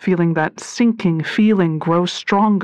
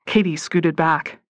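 usual speaking voice

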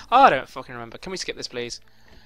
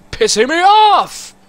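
much higher and louder, slowed, exploding; angry shout; 8 semitones higher, 6 dB louder than usual. Real, not acted. Gamer losing it mid-mission, furious shout. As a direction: let the anger burst out. Rage building at game.

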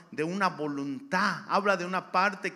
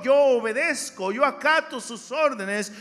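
loud, rising preacher cadence; fiery sermon delivery; preaching; 4 semitones higher, 7 dB louder than usual. Acted. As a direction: preach it to the congregation with force. Preaching at full force.

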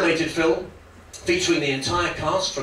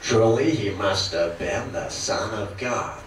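lightly voiced slow cowboy drawl; a hint of a Western hero, slow and low; slow mock-solemn John Wayne; John Wayne impression; 8 semitones lower, slower than usual. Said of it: "John Wayne as Saint Paul".

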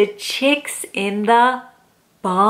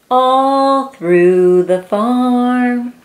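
drawn out slowly; slow refrain; 3 dB louder, much slower than usual. Acted. Reading to a child, slow sing-song refrain. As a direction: stretch each word out.

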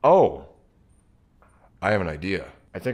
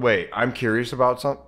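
usual speaking voice

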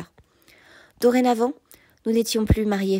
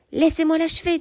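higher, urgent exclamation; fierce demand; 7 semitones higher, 3 dB louder than usual. A narrator's reading. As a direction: demand the kill in hot blood. Warrior demands to kill the king. (exigence farouche et pressante)

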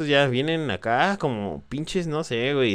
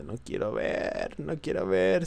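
scared whisper; 8 semitones higher, 4 dB quieter, slower than usual. Real, not acted. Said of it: "Siento todos mis huesos temblando".

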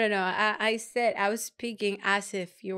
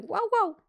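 high bark said as words; pitched-up dog bark; high dog bark wow; 10 semitones higher than usual. Shown as a demonstration. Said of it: "They're gonna be like".

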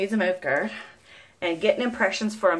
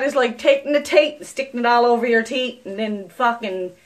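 ordinary talk, nothing marked

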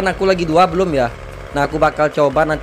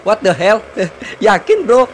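amused disbelief; 4 semitones higher than usual. Real, not acted. Gamer seeing a racer on foot, incredulous outburst. Amazed someone races on foot.

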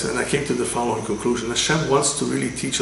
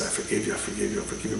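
much lower and softer, tender and reassuring; gentle forgiveness; 4 semitones lower, 8 dB quieter, faster than usual. Acted. Rabbi gently forgiving the young man, low and soft. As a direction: soothe him, let it go quietly.

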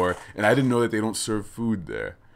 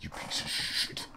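much softer, cursing under his breath; muttered under breath; 8 semitones lower, 16 dB quieter than usual. Retold or imitated. Retelling the pub barman, he mutters insults under his breath.

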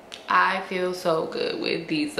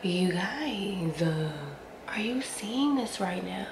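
unvoiced whisper to the viewers; 5 dB quieter than usual. Real, not acted. Hushed aside to her viewers, whispered. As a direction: lean in and whisper it.

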